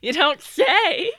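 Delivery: slow, high, mock-surprised; sarcastic. Real, not acted. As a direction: drag it out with playful sarcasm. Sarcastic reply to good Samaritans.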